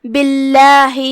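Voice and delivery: باللہ with a light, thin "lah"; ترقیق: say باللہ with thin, unheavy lam; thin Allah. Shown as a demonstration. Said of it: "ہم اسے پتلی آواز میں پڑھیں گے".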